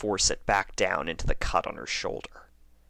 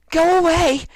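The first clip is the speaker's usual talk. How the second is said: screaming, far higher and louder; angry shout; 14 dB louder than usual. A narrator's reading. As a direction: scream it at them to leave. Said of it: "said Lyra".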